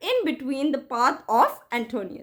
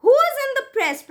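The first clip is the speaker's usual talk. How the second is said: commanding question; 9 semitones higher, 3 dB louder than usual. Acted. Caesar demanding from crowd.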